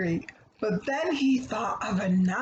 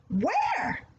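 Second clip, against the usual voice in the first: high, eager little pig; 11 semitones higher than usual. Acted. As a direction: pop the question up like a curious piglet.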